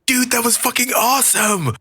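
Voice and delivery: high-pitched, thrilled yell; high kid voice, held-back shout; excited kid yelling. A narrator's reading. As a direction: call it from across the street like an amazed kid. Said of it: "a kid yelled from across the street".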